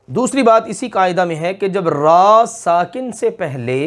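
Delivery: ordinary talk, nothing marked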